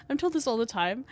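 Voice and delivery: ordinary delivery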